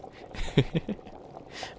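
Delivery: quick breathy villain cackle; evil laugh attempt. Witch testing her evil laugh on a listener, cackling. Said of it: "I'm trying to work on my evil laugh".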